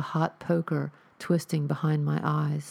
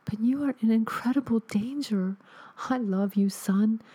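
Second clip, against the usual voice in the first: higher, pleading ghost voice; urgent ghostly mother; 6 semitones higher than usual. Acted. Ghost mother warns her son of danger, high and urgent. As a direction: warn him of danger from beyond. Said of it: "My mother's voice was soft and delicate".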